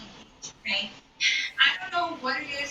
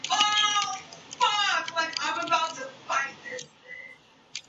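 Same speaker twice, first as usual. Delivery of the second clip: high, drawn-out oh-no reaction; retold panic; 9 semitones higher, much slower than usual. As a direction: relive the sinking dread, high-pitched and stretched. Retold panic as fight becomes real.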